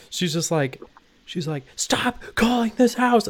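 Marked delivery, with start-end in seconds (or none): 1.8-3.3 mock furious high yell; freaking-out lady. Imitating a fed-up lady answering yet another prank call, high furious outburst. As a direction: imitate a woman losing it on the phone. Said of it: "freaking out".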